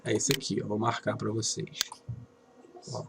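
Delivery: said softly, half to oneself; quiet aside. Real, not acted. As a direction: drop the volume as if busy marking something. Aside while marking the board.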